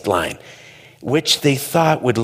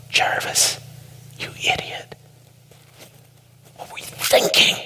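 hissed whisper at himself; man muttering insults at himself after oversleeping; self-scolding whisper. Retold or imitated. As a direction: berate yourself under your breath. Scolding himself under breath.

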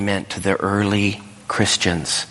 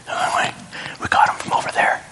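fast secret whisper; hushed whisper; faster than usual. Retold or imitated. Servants whispering to the master of ceremony, quick hushed whisper. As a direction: whisper it quickly, a little nervous.

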